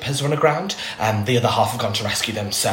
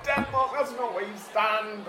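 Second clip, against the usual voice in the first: high, slow call from far off; faint, far-off exasperated call; distant shouting; 11 semitones higher, 7 dB quieter, slower than usual. Acted. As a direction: yell across the stadium, away from the mic. Organiser walks off shouting, contact lost.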